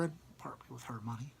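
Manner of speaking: soft unvoiced aside; whispered aside. A narrator's reading. Narrator whispers an aside to listeners.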